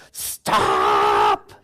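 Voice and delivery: exasperated wife, squeaky plea; wife's pleading stop. Retold or imitated. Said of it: "wants to look at the T V and say".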